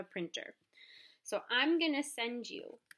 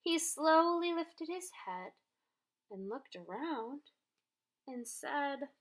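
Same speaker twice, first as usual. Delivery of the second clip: put-on bright, lively read-aloud for kids; animated children's story narration; storybook reading; 3 semitones higher than usual. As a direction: read it like circle time with the class. Teacher reads story to class.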